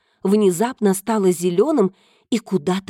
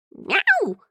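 cat yowl. Acted. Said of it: "услышала она громкий вопль".

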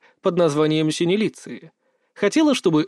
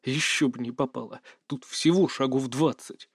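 low, soft grumble; subdued, grumbled retort; grumbling; 3 semitones lower, 7 dB quieter than usual. A narrator's reading. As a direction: grumble it quietly, pitched down. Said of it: "буркнул я".

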